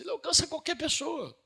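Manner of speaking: thrown up high; ecstatic proclamation. Acted. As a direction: proclaim it to the whole church. Climax of the sermon.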